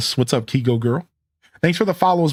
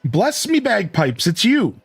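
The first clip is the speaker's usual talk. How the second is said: high, drawn-out Scottish greeting; lilting Scottish burr, pitched up; Scottish accent; 7 semitones higher, slower than usual. Acted. Character greets a visitor in a game, Scottish accent. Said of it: "It's the Scottish accent makes the joke".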